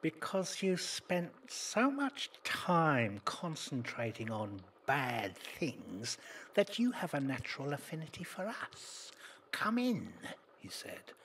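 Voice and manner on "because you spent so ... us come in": slow, higher, eerie demon; demon's voice. Acted. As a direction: lure him in like a gatekeeper demon. Demon at hell's gate.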